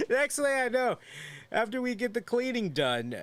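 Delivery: high-pitched, amused squeal of a voice; laughing. Real, not acted. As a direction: squeeze the words out high after a laugh. Breaks up laughing mid-story.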